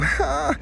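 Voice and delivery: high soft whimpering ah; imitated toddler cry. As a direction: copy the little girl's first weak cry. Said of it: "she started to cry and go".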